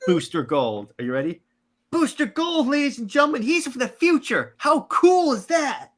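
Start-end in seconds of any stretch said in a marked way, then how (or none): 1.9-5.9 very high-pitched hype man; squeaky, excited announcer selling a hero; Skeets. Acted. Sidekick hyping Booster Gold to the crowd, very high excited voice. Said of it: "I'm gonna be Skeets".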